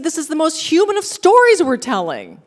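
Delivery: high, loud earnest insistence; Bruce insisting. Retold or imitated. Bruce telling her the story is human. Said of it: "He said".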